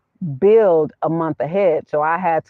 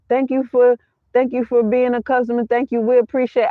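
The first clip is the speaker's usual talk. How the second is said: higher-pitched imitation of customer-service sweet talk; mock sweet-talk; 6 semitones higher than usual. Phone rep thanking a customer, mock sweet-talk. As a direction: gush scripted thanks, mimicking a phone rep. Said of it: "I don't need all the sweet words".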